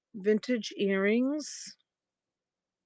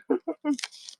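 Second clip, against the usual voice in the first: high, light chuckle; 7 semitones higher than usual. Real, not acted. Seller recalling a viewer's chat message, amused chuckle. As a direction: chuckle to yourself after the anecdote. Amused by her own retold story.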